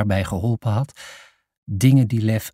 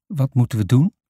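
ordinary delivery

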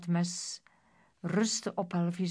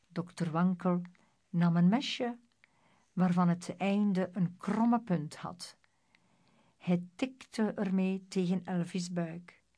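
usual speaking voice

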